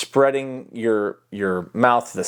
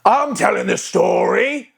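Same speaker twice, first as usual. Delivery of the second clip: high, slow, belted pirate yell; character yell; 7 semitones higher, slower than usual. Shown as a demonstration. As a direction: belt it out slowly as the interrupted pirate. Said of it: "into yelling".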